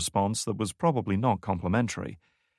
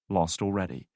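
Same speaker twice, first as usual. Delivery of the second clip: ordinary delivery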